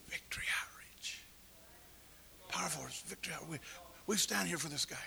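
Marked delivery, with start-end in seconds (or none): 0.1-3.6 hushed whisper. Acted. Whispering the answer to the judge's question. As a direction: breathe the name like a secret.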